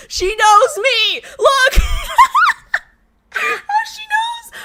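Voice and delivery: high, loud, laughing squeal; giddy shriek; delighted squeal. Real, not acted. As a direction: squeal like the fan truly gets you. Thrilled by a fan message.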